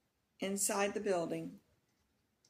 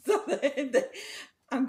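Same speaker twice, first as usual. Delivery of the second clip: higher, louder, through real laughter; laughing; 7 semitones higher, 7 dB louder, faster than usual. Real, not acted. Storyteller losing it over her own roller coaster fear, laughing. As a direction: break into laughter while restarting.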